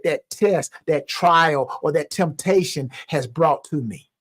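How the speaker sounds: ordinary talk, nothing marked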